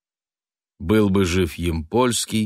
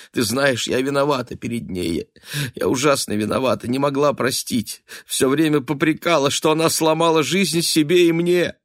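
feverish, rambling; 8 semitones higher, 4 dB louder than usual. A narrator's reading. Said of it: "заговорила лихорадочно и бессвязно, как сумасшедшая".

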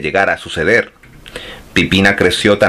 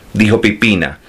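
usual speaking voice

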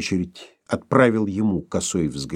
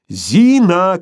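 high, loud call; shouting; 8 semitones higher, 7 dB louder than usual. A narrator's reading. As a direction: shout her name. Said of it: "кричал Борменталь".

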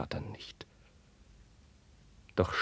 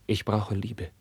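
normal talking voice

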